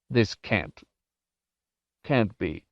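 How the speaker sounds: halting, breaking off mid-phrase; faltering. A narrator's reading. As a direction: falter as disbelief gives way. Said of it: "He faltered".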